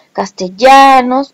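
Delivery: "Castellanos" slowed, with a long a in "-lla-"; stressed A. Shown as a demonstration. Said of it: "última vocal acentuada la A".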